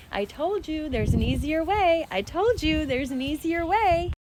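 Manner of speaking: high slow faint echo; echoing inner voice. Retold or imitated. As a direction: let it echo like a voice far away. Voice repeating in her mind.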